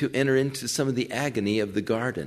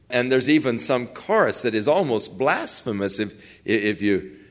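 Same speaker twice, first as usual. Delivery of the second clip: usual speaking voice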